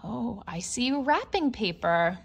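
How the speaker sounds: ordinary delivery, nothing marked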